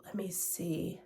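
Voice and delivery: whispered to herself while searching. Real, not acted. Checking the book's date, whispered to herself. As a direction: breathe it under her breath.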